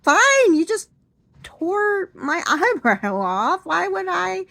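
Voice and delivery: high, loud sarcastic denial through a laugh; mock-sarcastic "fine". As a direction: insist you're fine while clearly in pain.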